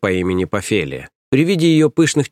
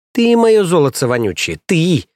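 gloating; 7 semitones higher, 3 dB louder than usual. Acted. Nanny gloats over idiot.

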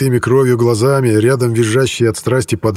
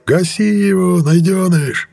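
much higher yell from the stands; yelling from above; 9 semitones higher, slower than usual. A narrator's reading. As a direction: yell down from the balcony. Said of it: "Со второго этажа орали".

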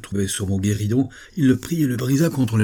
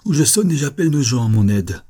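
raised voice, high then descending; firm, heated threat; indignant threat; 5 semitones higher, 5 dB louder than usual. Acted. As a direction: warn him loudly that help will come. Threatens to ring for servants.

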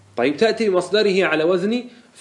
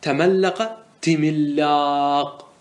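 slow demonstration; much slower than usual. Shown as a demonstration. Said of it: "سمعنا بعض العرب يقول".

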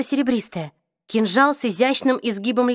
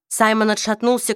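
ordinary delivery, nothing marked

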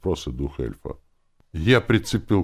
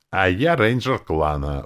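raised, slow proud protest; exclaiming; 4 semitones higher, 5 dB louder than usual. A narrator's reading. Lenora asserts her clan rank, exclaiming. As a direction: declare it emphatically, drawn out. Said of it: "воскликнула она".

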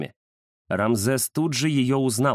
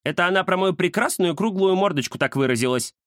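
higher-pitched cat grumbling mildly; reluctant grumble; 8 semitones higher than usual. A narrator's reading. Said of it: "неохотно буркнул Кузя". (неохотное ворчание высоким голосом)

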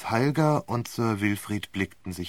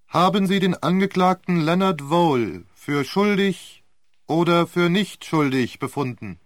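court clerk; 8 semitones higher, 8 dB louder than usual. Acted. Court clerk asks the verdict.

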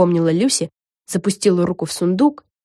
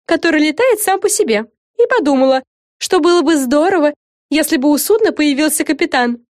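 high, lively TV presenter voice; showy reporter Fren; 9 semitones higher, 4 dB louder than usual. Acted. Fren opens an interview for her show, presenter-like. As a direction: present it brightly to the camera.

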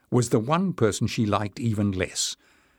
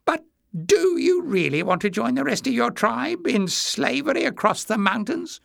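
high-pitched, needling little man; haughty goading; 14 semitones higher than usual. A narrator's reading. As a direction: goad in a high voice. Jinx goading Glock.

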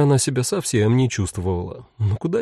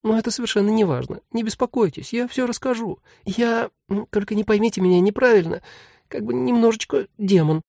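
quick, very high little voice; hasty tiny voice; 10 semitones higher than usual. Acted. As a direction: rush to reassure, tiny demon. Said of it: "поспешно добавила".

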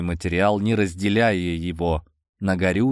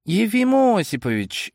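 high, glad exclamation; exclaiming; 11 semitones higher than usual. A narrator's reading. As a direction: call out the name warmly. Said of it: "воскликнул я".

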